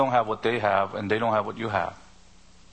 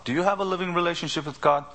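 higher, quicker earnest question; pointed question; 6 semitones higher, faster than usual. Retold or imitated. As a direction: press the question, pitched up and quick.

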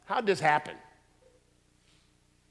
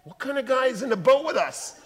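amazed disciples; 10 semitones higher, 7 dB louder than usual. Acted. Awed disciples.

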